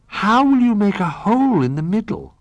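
high, loud, wondering question; curious little bear. A narrator's reading. Little Bear questions Old Bear about the lid, high and curious. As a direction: ask in a high little bear's voice.

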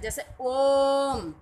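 ओम with the vowel held very long, about three beats; prolonged vowel demo. Shown as a demonstration. Teacher holding ओम long to show a prolonged vowel. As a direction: stretch the ओ in ओम far out. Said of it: "तिगुना समय लगता है".

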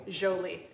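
"Jolie" opening on a French zh; corrected soft J. Shown as a demonstration. Painter giving the owner's version, "Jolie" said zho-lee. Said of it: "and so it's Jolie".

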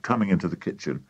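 usual speaking voice

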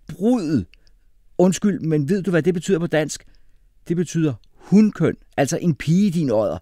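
loud, high-pitched indignation; shouted objection. A narrator's reading. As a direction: protest in outraged disbelief. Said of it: "råbte Jeppe".

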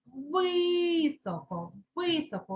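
високо with a long, drawn-out «и»; stressed first syllable. Shown as a demonstration. Teacher puts the vowel under stress, високо with long «и». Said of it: "ставимо під наголосом".

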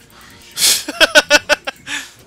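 loud high-pitched burst of laughter. Real, not acted. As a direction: let out a big giggle. Laughs after mistake.

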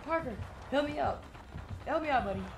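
high, urgent plea for help; panicking gamer. Real, not acted. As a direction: beg a teammate in panic. Begging teammate while chased.

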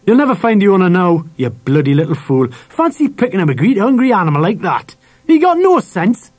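angry tirade rising high and loud; angry scolding. A narrator's reading. Dad scolds son for picking up dog, angry. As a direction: berate him, climbing to a peak.

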